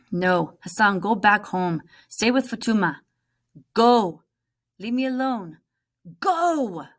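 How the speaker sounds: loud, sharp impatient commands; impatient scolding. Acted. Omar angrily sending his little brother home. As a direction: send him away harshly.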